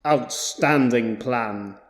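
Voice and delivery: dry and sarcastic, words drawn out slowly; slow sarcasm. A narrator's reading. Hawker mocking the boss's plan to himself, slow dry sarcasm. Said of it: "Hawker sarcastically thought to himself".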